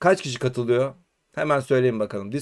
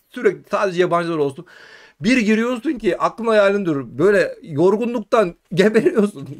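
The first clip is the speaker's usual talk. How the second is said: usual speaking voice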